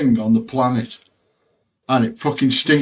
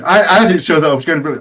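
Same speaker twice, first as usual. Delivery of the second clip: ordinary delivery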